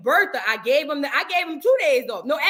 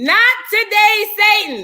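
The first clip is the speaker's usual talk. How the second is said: loud catchphrase; 8 semitones higher, 9 dB louder than usual. Acted. Host refusing to discuss Yandy, loud high rebuke. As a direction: belt it out to ward off Satan.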